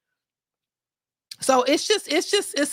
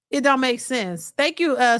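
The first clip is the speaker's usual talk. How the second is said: ordinary talk, nothing marked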